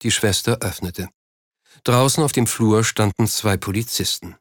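ordinary delivery, nothing marked

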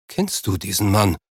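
understated stunned question; a touch of bewilderment. A narrator's reading. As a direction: ask in mild disbelief. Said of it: "fragte Tim fassungslos".

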